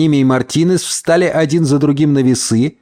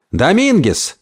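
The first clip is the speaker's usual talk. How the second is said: higher-pitched call of a name; 7 semitones higher, slower than usual. Acted. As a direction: call to someone across a room. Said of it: "позвал Гомес".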